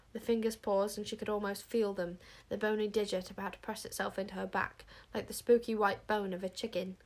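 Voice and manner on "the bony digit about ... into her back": ordinary delivery, nothing marked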